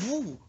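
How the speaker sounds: very high "woo"; high swooping sound effect; whoosh of a dunk. Retold or imitated. Imitated dunking sound in story.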